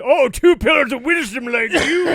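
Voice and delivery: loud, high, slow mocking read; big drawn-out sarcastic jeer. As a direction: exaggerate the sarcasm at full volume. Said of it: "you read it in a sarcastic tone".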